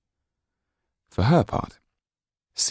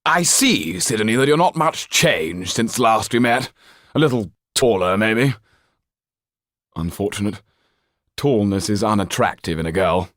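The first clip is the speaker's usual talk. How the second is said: higher and louder, a cold aristocratic father; haughty Marquis; 4 semitones higher, 5 dB louder than usual. Acted. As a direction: look down your nose at your daughter. Marquis speaks to daughter.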